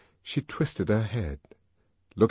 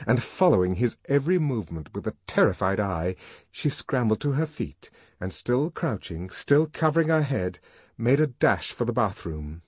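ordinary delivery, nothing marked

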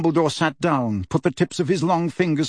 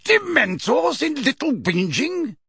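great surprise; 13 semitones higher than usual. A narrator's reading. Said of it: "in tones of great surprise".

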